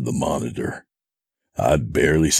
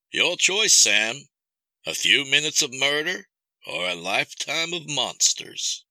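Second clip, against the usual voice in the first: menacing captor; 11 semitones higher than usual. Acted. Captor offers Sam a choice of horrors, menacing. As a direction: coolly offer the terrible bargain. Kidnapper's voice in narrated story.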